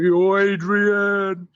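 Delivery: long drawn-out, high, loud call; mimicking people yelling out a movie catchphrase; imitated yell. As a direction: holler it out, stretching the name. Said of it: "people yelling".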